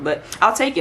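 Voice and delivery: usual speaking voice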